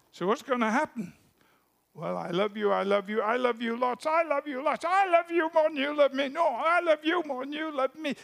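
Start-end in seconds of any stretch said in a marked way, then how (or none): 1.9-8.2 escalating lovers' banter. Acted. Two lovers outbid each other, rising giddy. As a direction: build giddily till it almost squeals.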